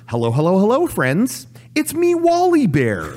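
put-on friendly kids' mascot bear; Wally Bear voice. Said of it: "it would be a bear".

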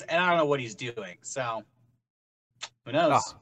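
normal talking voice

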